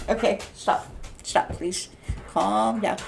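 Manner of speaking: whispered pleading to stop. Real, not acted. Shushing her pushy dog, in a whisper. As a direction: hush the dog under your breath.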